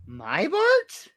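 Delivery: Homer surprised. Retold or imitated. Host voicing Homer startled at the producer, high put-on voice. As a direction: squeak it up, startled.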